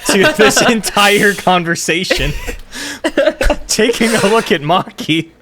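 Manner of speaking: can't stop laughing while talking. Real, not acted. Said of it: "and I lost it".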